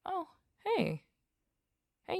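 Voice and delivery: ordinary delivery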